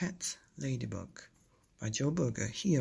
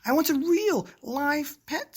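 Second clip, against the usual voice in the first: much higher, louder demand; shouted; 13 semitones higher, 7 dB louder than usual. Acted. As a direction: shout it like a cross little girl. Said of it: "she shouted".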